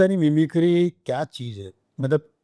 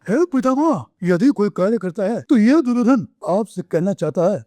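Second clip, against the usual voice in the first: higher, booming epic-hero declamation; Puneet Issar as Duryodhan; 5 semitones higher than usual. Retold or imitated. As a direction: proclaim it like a Mahabharat prince addressing his grandsire. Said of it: "Puneet जी की आवाज़ में".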